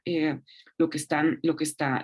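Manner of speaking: ordinary talk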